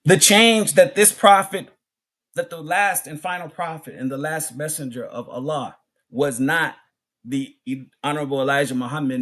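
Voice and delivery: normal talking voice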